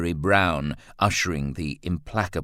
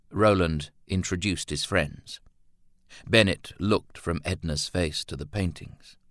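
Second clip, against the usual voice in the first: ordinary delivery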